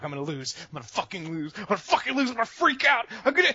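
acted mounting gamer meltdown, pitch rising with each repeat; building panicky rage. Gamer losing a match works up to freaking out, building rage. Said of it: "there's something that builds inside of you".

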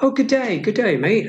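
lightly hammed-up Australian greeting; a hint of Aussie twang in good day mate; playful mock Australian accent. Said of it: "it's just their accent".

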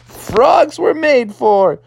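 high, elated dad voice; thrilled frog dad. Acted. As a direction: call up to your son, full of bravado. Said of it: "And he was like".

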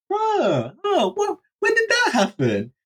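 high-pitched mock bewilderment; bewildered shock. Storyteller finding his head on the drain roof, high shocked squeal. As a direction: squeal it in hammed-up surprise.

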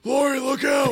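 high-pitched hammed-up alarm; high melodramatic movie yell; mock warning shout. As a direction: call out a warning in mock panic. Acting the counselor's warning.

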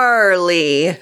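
GNARLY stretched out long and slow; drawn-out gnarly. Shown as a demonstration. Skater telling of a bad fall, gnarly drawn out. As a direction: draw out the word gnarly for emphasis. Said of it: "the way that you say it".

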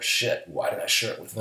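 low, hushed regretful mutter; a quiet self-reproach. Retold or imitated. Regretful inner voice quoted.

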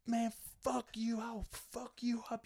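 comic imitating a drunk heckler's threats; mock drunk heckler threat. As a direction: mimic a drunk kid slurring a threat, pitched high.

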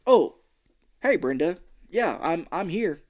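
mock nonchalance; acted casual phone talk. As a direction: play it falsely fine on the phone. Feigned calm phone call, retold.

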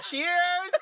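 high, loud cheers; laughing shout of cheers while drinking. Real, not acted. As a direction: toast with a shout. Toasting loudly.

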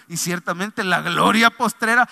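ordinary delivery

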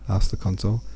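usual speaking voice

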